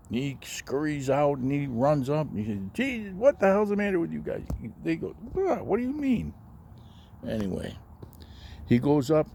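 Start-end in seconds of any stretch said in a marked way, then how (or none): 2.7-4.4 high, fed-up outburst at the guys; exasperated. Retold or imitated. Little Dick, out of the manhole, exasperated at the brothers. 5.3-6.3 very high, fake-innocent what; mock surprise. Brothers pretending surprise to Little Dick.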